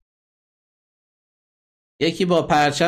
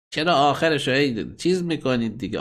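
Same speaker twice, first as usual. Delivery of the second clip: ordinary delivery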